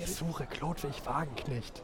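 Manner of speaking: softly repeated after being shushed; discreet, hushed inquiry. Acted. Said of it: "nicht so laut".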